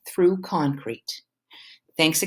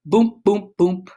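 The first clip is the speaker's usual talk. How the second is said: beatboxed bass thumps, louder and higher; beat imitation; 4 semitones higher, 4 dB louder than usual. Narrator imitating the opening beat of their song, mouth-thumping. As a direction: thump out the bassline with your mouth.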